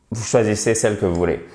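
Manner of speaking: usual speaking voice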